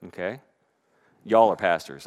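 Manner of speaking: ordinary delivery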